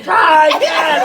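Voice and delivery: high, urgent mock yell; shouting in face. Son yelling in dad's face to drive away. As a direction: push him, yelling. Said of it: "in my face going".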